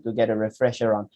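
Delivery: ordinary talk, nothing marked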